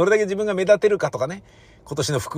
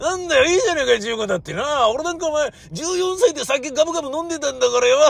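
higher, rough bragging with a laugh; much higher, hammed-up festival braggart; boastful old-timer; 11 semitones higher, faster than usual. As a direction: brush off the worry like a rowdy old man.

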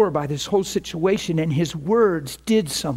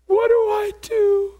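much higher, strained voice of someone falling apart; imitating desperate crying; slower than usual. Preacher voicing the man who phoned back, high and despairing. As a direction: play a broken man begging for help, pitched way up. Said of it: "That's what they're saying".

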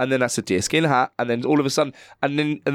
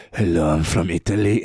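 much lower put-on voice; corrected imitation; 9 semitones lower than usual. Shown as a demonstration. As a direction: drop it low, that's the one. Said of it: "Yeah, that's the voice".

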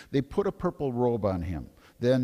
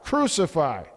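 higher, louder double cry; read crowd shout; 7 semitones higher, 4 dB louder than usual. A narrator's reading. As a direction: cry it out as the priests. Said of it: "they shouted".

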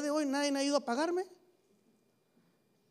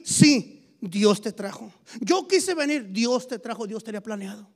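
louder emphatic preaching; 6 dB louder than usual. Acted. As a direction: press each line home. Preaching at full force. (predicando con fuerza)

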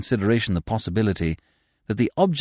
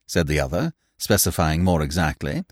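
usual speaking voice

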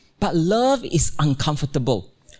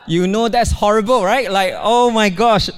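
exasperated exclaim; 5 semitones higher, 4 dB louder than usual. Real, not acted. Exasperated aside about daughter's choice.

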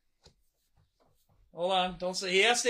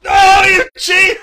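loud, high mock-outraged shout; accusing shout; 9 semitones higher, 17 dB louder than usual. Real, not acted. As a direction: call him out loudly. Host catches contestant cheating.

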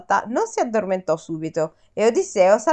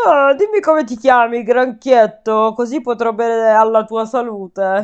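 giant voice; 3 dB louder than usual. Acted. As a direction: play the greedy giant. The giant speaks.

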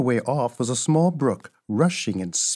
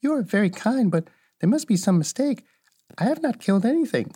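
put-on a little girl's unsure reply, pitched up; hesitant; 8 semitones higher, faster than usual. Dorothy answers the witch's strange thanks, hesitantly. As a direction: voice Dorothy politely unsure of herself. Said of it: "said with hesitation".

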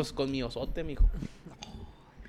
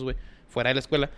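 ordinary talk

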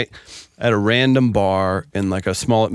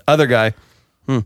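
ordinary talk, nothing marked

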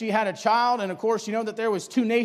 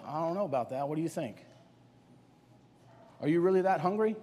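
gentle questioning; 5 semitones lower, 8 dB quieter, faster than usual. Acted. The Lord's inner voice questioning Esau.

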